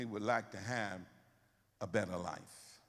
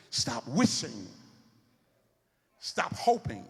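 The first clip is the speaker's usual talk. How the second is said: loud, high preaching build; preaching at full force; 5 semitones higher, 7 dB louder than usual. Acted. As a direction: preach it out, rising and pounding.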